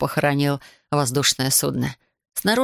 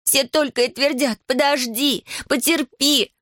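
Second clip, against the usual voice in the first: put-on higher, angry yelling girl; shouting; 8 semitones higher than usual. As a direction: yell it in frustration. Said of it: "продолжала орать".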